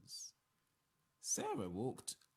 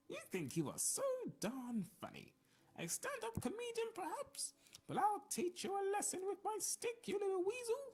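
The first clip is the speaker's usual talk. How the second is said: put-on high, scolding old woman's snarl; old wife snarls at the taxman, waving her stick. A narrator's reading. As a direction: threaten him like a cranky old lady with a stick. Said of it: "Sarah snarled as she wavered her stick".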